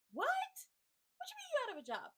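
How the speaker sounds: high, loud squeal of disbelief; what, you lost your job?!; shocked. Retold or imitated. Friend hearing she's out of a job, squealing in shock.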